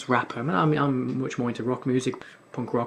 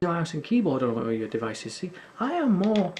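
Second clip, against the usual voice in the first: ordinary talk, nothing marked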